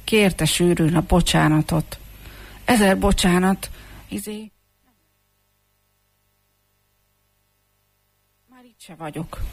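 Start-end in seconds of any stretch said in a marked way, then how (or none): 2.7-9.4 flustered stammering apology. Acted. Comb begs the bald man's pardon and slips away, flustered and stammering. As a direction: start higher, falter, then shrink away almost to nothing. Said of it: "Megzavarodva hebegett".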